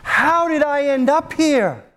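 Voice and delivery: high, pleading wail to God, voice strained near tears; wailing lament. Retold or imitated. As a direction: cry out to God as a lost soul, bewildered and begging. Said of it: "weeping, sobbing, saying".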